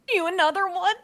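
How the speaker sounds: high, small gnome voice peeking out; timid gnome apprentice. Acted. Game master voicing a gnome hiding in cloth, high and timid. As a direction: squeak it out like a frightened little gnome. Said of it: "And she goes".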